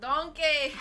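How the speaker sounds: high, loud ogre holler; Shrek. Acted. Told to just talk like Shrek, loud impression. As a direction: bellow it like a cartoon ogre. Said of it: "You just talk like Shrek".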